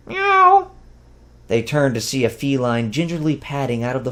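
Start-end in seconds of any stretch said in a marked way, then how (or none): 0.1-0.7 squeaky high kitten mew; high cat meow. A narrator's reading. Narrator voicing the cat with a high meow.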